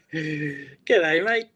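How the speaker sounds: guest's put-on Australian accent, played for fun; a cheerful stock Australian greeting in an Aussie accent. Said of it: "give me your best Aussie accent".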